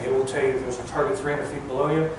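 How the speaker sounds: normal talking voice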